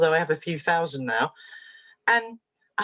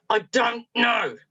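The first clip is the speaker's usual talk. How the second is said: slow, higher, each word separated; exasperated child; 5 semitones higher, much slower than usual. Retold or imitated. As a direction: imitate a boy fed up with being pressed. Boy's exasperated final repeat.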